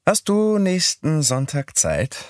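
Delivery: put-on a bright young woman asking a date; Midori's voice. A narrator's reading.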